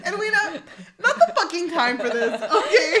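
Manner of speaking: loud, high, laughing reprimand; mock exasperated scolding. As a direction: scold loudly while fighting laughter. Kate cuts off sister, acted.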